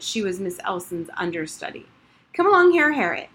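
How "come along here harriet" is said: high, loud, bossy command; imperious. A narrator's reading. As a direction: order her over like a little headmistress. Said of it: "she said imperiously".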